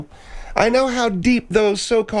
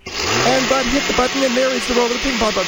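play-by-play; faster than usual. Acted. Sportscaster call of the ball roll.

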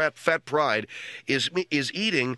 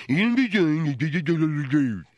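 slow mumble through a full mouth; imitating mouth full; 2 semitones higher, much slower than usual. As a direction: mimic words mashed by chewing. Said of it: "All I heard was".

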